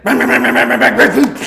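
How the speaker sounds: loud, high rapid mechanical banging; imitated engine banging. Telling how his engine broke down on the road, imitated loud engine banging. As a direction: mimic a failing engine, loud and abrupt. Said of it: "it just made this sound".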